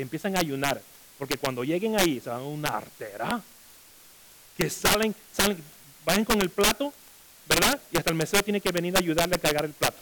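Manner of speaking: usual speaking voice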